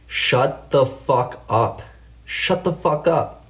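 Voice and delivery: drawn-out, fed-up telling-off; ranting. Real, not acted. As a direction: space out every word in irritation. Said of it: "I'm gonna rant here for a second".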